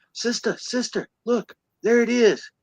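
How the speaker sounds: high-pitched imitation of an excited kid; excited child. Acts out child calling to sister.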